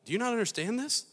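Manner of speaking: high, quick questioning; incredulous. Acted. Jesus asking a baffled Martha, high and incredulous.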